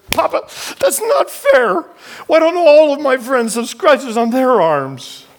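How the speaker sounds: high, loud, slow, broken plea through tears; a child stammering out his outrage through tears; stammered tearful plea. Retold or imitated. Boy protests to his father, stammering, high and tearful. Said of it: "and I stammered".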